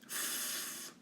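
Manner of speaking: a whispered "F-" sound, unvoiced. A narrator's reading. Child sounds out her name, whispered F-. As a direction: breathe out a soft "F-" like a child sounding out. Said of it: "Phoebe sounded out the first letter".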